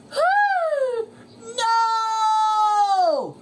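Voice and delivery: very high gasp into a long scream; SpongeBob shouting. Acted. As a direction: cry out in horror. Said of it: "shouted SpongeBob".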